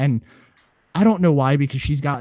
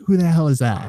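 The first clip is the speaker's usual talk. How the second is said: a touch of a mom's blunt disbelief, a little higher and louder; baffled mom; 5 semitones higher, 5 dB louder than usual. Retold or imitated. Mom baffled at airport security.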